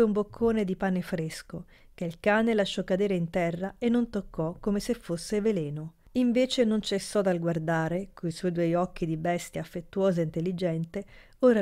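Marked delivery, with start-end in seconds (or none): none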